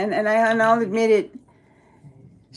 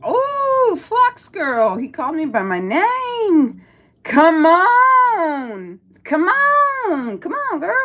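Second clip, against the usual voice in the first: much higher, squealing excitement; excited squeal; 7 semitones higher, 3 dB louder, much slower than usual. Real, not acted. Streamer squeals because a viewer called her name, high and excited. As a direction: squeal with delight at being named. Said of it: "You sound like a girl".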